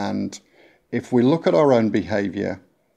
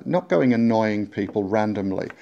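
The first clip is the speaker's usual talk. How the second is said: ordinary talk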